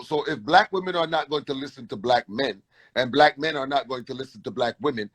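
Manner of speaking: ordinary talk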